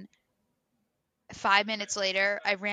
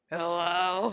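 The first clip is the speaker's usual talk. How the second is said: deep scratchy morning voice; drunk morning voice; 3 semitones lower than usual. Shown as a demonstration. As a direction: croak it like just woke hungover. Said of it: "drunk morning voice".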